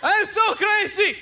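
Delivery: high, drawn-out ad-man impression; hammed-up slow, high pitch; mock crazy pitchman. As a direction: imitate a TV salesman declaring himself crazy. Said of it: "trying to tell you how crazy he".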